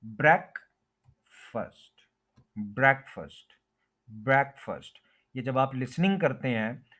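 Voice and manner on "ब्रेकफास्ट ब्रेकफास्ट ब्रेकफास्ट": ब्रेकफास्ट as "BREK-fust", both vowels short; correct pronunciation. Shown as a demonstration. Teacher modelling for learners, careful pronunciation. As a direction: stress "brek" in ब्रेकफास्ट, reduce the ending to "fust". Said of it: "इसको short करके बोलेंगे".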